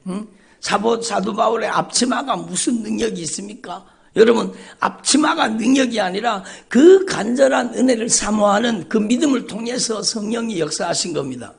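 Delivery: usual speaking voice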